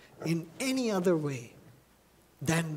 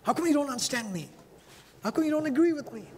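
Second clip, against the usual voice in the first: higher, fast, self-pitying whine; whiny complaint; 9 semitones higher, much faster than usual. Retold or imitated. Preacher mocking his own demand to be understood, higher and whiny. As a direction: rattle off the complaint like a hurt child. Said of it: "I've done that a lot".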